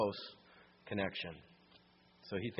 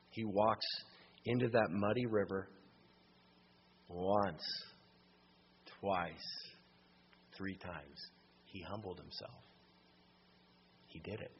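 hushed storytelling; 7 semitones lower, 7 dB quieter than usual. Acted. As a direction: draw them in, almost under your breath. Quiet climax of story.

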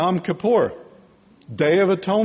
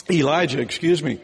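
ordinary talk, nothing marked

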